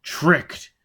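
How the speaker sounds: startled man exclaiming. A narrator's reading. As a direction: realise the trick out loud. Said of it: "he exclaimed".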